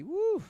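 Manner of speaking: loud, high-pitched "woo"; high whoop. Real, not acted. Streamer reacting to a viewer's comment, whooping high and loud. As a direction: let out a big high whoop. Whoop while reading chat.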